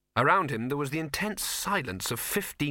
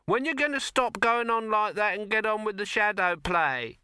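heckling woman; 10 semitones higher than usual. Acted. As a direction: jeer at the showman.

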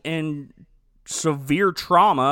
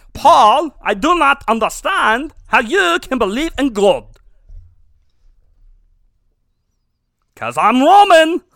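loud, higher hammed-up French voice; mock French accent; 7 semitones higher, 10 dB louder than usual. French prefect questioning Paul, loud mock French accent. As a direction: lay the French accent on thick. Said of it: "that's how he talks".

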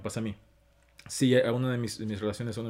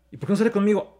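higher, indignant jealous question; indignant complaint; 7 semitones higher, 4 dB louder, faster than usual. Retold or imitated. Man voicing his jealous self: higher indignant complaint. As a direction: demand it like a hurt partner.

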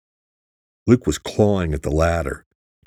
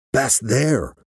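mild, higher-pitched narrated yell; urgent yell; 7 semitones higher than usual. A narrator's reading. Jack bursting into Rhodes's office with news, urgent yell. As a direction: raise the pitch in urgent alarm. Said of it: "he yelled".

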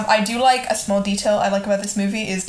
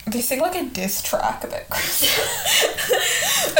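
laughing whisper; 9 semitones higher, 3 dB quieter, slower than usual. Real, not acted.

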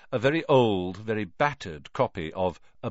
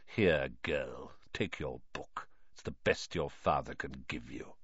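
lower, softer, cold sneer; with malice; 7 semitones lower, 9 dB quieter than usual. Acted. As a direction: hand it over with quiet malice. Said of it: "his eyes glittering with malice".

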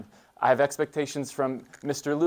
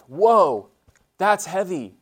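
mock awe; 6 semitones higher, 8 dB louder, much slower than usual. Real, not acted. Reacting to a list of expectations.

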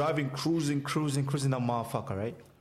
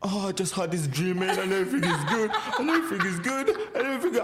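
high-pitched giggling through the words; giggly high friend; 5 semitones higher than usual. Retold or imitated. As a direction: play the stoned friend laughing at nothing. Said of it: "She's like".

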